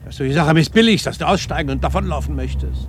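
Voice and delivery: husband shouting at his indifferent wife in frustration; exasperated outburst. Acted. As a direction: demand a real answer from her, fed up.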